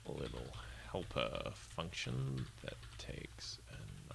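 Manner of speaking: very soft, slow, half-whispered; murmuring while typing. Real, not acted. Streamer writing down a viewer's idea, murmured to self. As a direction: murmur it slowly to yourself, word by word.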